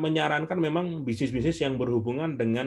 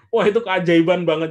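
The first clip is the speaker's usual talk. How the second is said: laughing, louder and delighted; joyful laugh; 4 semitones higher, 7 dB louder than usual. Real, not acted. Speaker recalling the debt paid off, laughing with joy. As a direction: laugh with wonder.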